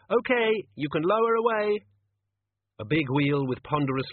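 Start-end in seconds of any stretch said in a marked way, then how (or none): 0.1-1.8 high, loud instruction; raised, high-pitched shout down; calling from above. A narrator's reading. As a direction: call down to the one below. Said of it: "said a voice from above".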